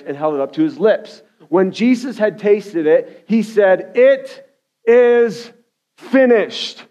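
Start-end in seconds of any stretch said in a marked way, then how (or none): 3.9-6.8 raised, high cry in three separate bursts; yelled declaration. Acted. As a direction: proclaim it loudly, pausing after each word. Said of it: "And he yells out".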